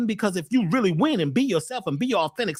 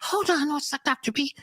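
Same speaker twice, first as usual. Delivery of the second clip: mock caller voice; 8 semitones higher than usual. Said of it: "the other phone ring".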